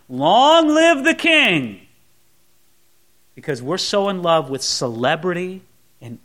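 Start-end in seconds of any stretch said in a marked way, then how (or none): 0.1-1.8 crowd shout. Retold or imitated. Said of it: "shouting out".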